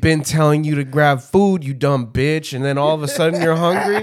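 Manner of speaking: loud retold tirade, rising as it goes; angry rant. Scolding friend who refused food, loud mock-angry rant. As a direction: build an exasperated yell, a laugh creeping in. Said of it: "I was angry".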